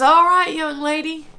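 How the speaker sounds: a hint of the cheerful doctor; imitating the doctor. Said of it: "he says".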